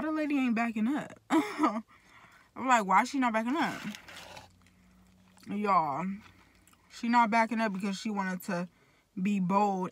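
speaking between bites; talking while chewing. Real, not acted. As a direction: mouth partly full. Seen 4: Puts food in mouth at start, then talks with chewing mouth.

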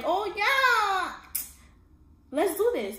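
high, gushing mock agreement; naive eager yes. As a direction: play the gullible girlfriend jumping at it. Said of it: "I'm like".

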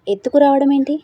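astonished question. A narrator's reading. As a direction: question it in disbelief, voice jumping up. Maid shocked at the abduction. (ఆశ్చర్యపోయి గట్టిగా)

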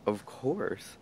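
light, carefree reply with a lift on "course"; breezy, easy yes; happy-go-lucky. Retold or imitated. Answering the man who asked to be seen, happy-go-lucky. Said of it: "happy-go-luckily said".

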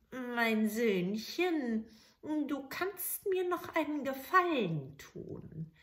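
put-on very slow, drawn-out sweet old hag; wheedling witch. Witch asking the soldier a favour, wheedling. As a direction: stretch out Söhnchen like honey.

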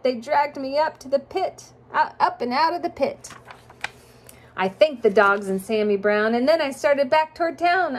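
sing-song rhyming storybook read; reading to children. A narrator's reading. Parent reading a rhyming picture book to a child, sing-song. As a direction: lilt the rhymes for a child listener.

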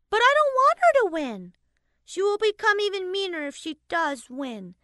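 high, fast, whiny protest; a little girl pushing back at her dad; complaining child. A narrator's reading. Daughter complaining to her father, a high whiny child voice. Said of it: "Haley complained".

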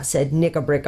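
plain reading voice

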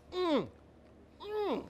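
high "mm" of delight while chewing; mouth full. Real, not acted. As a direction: hum with pleasure through a bite. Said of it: "Don't talk with your mouth full".

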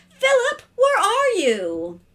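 high, loud drawn-out call; singsong summons, very high start; calling upstairs. A narrator's reading. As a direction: holler for a child upstairs. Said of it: "called upstairs to her husband, and to her little boy".

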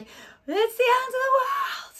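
very high, loud, drawn-out doomsday cry; hammed-up hysterical news alarm; panicked news. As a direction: wail it slowly like the sky is falling. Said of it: "versus the British news about how we're like".

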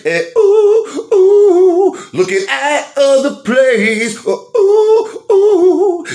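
high, loud ooh ooh wailing; frustrated wail. Real, not acted.

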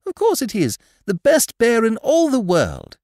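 put-on high, loud proud child; excited. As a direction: proclaim it with delight. Christopher Robin character voice.

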